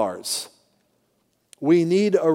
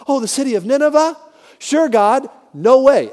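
high, quick feigned compliance, then louder refusal; mock-agreeable, then refusing; 7 semitones higher, 5 dB louder than usual. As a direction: play a reluctant prophet balking at orders. Acting out Jonah balking at God.

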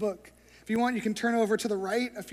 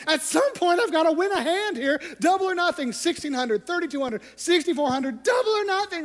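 higher, louder, growing frantic; frantic gambler; 8 semitones higher, 6 dB louder than usual. Retold or imitated. Gambler thinking as losses double, rising frantic. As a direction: spiral into the bet. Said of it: "And he's thinking".

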